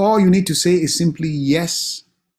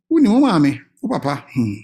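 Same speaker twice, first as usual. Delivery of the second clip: ordinary talk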